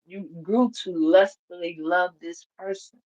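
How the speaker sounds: ordinary talk, nothing marked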